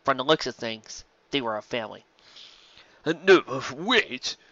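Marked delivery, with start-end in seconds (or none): 3.0-4.4 begging. Acted. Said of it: "Castercut begged".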